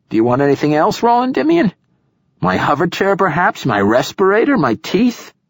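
high, mocking old man; sarcastic. A narrator's reading. Sarcastic old poet offering everything.

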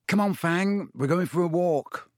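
high, cheery coaxing; calling a dog. A narrator's reading. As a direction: call the dog along for a walk. Harry calling the dog for a walk.

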